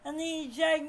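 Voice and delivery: ordinary delivery, nothing marked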